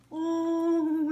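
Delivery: ordinary delivery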